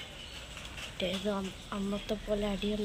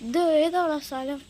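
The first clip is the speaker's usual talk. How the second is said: alarmed loud question; 6 semitones higher, 6 dB louder than usual. Acted. Lost in a strange place.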